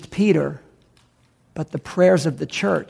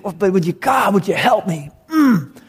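acted desperate plea, pitched up in surges; crying out; 3 semitones higher than usual. Father begging God to help his boy, acted desperate cry. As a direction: plead in anguish, pushing the stressed words higher. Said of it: "he's, he's crying".

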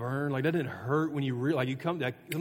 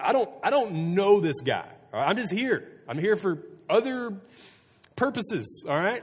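raised, high-pitched protest; stronger denial; 8 semitones higher, 6 dB louder than usual. Acted. Peter denying Jesus to a servant girl, vehement protest. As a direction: push back loudly and insistently, pitch climbing. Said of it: "This time even stronger. He says he denied it with an oath".

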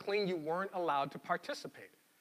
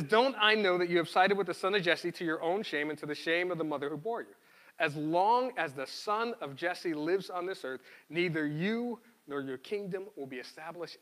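louder, measured Bible reading voice; scripture reading; 4 dB louder than usual. Acted. Pastor reading Samuel aloud to the congregation, louder read-aloud. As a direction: read the passage out to the church. Pastor reads Saul's words.

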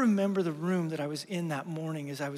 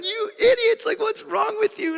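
much higher, louder mock rant at the disciples; exasperated outburst; 12 semitones higher, 4 dB louder than usual. Pastor imagining scolding the apostles, a hammed-up exasperated shout. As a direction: blow up at the foolish disciples, half joking. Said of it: "I was sitting there thinking to myself".

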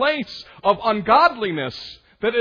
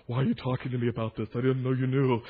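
mock defensive whine; 10 semitones lower, 5 dB quieter, faster than usual. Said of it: "And they say".

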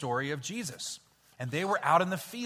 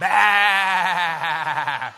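loud mock sheep "Bah!"; sheep bleat; 9 dB louder than usual. Shown as a demonstration. Teacher showing the shepherd sound, loud bleat. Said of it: "we're gonna say".

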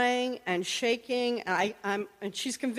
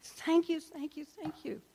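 higher, fading thank-yous, trailing off softer; relieved thanks; 7 semitones higher, 5 dB quieter than usual. Retold or imitated. Esther thanking God after her release: relieved, fading thanks. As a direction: let the relief pour out, fading away. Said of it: "I could feel the relief in her voice".

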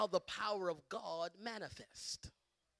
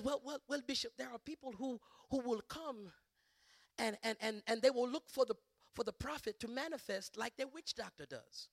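imitating a first lady's African accent; hesitant, explanatory African accent. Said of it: "African accent".

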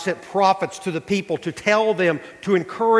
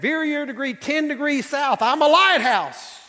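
punchline; 9 semitones higher than usual. Retold or imitated. Punchline reply from lighthouse.

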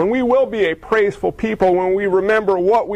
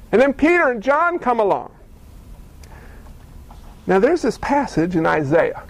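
ordinary talk, nothing marked

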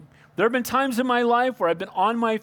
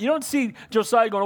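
ordinary talk, nothing marked

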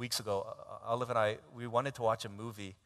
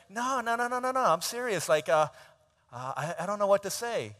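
high, hurried flustered protest; flustered girlfriend; 7 semitones higher, 6 dB louder than usual. Retold or imitated. Girlfriend insisting to her boyfriend she's serious, flustered and high. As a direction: squeak out no no no, rattled.

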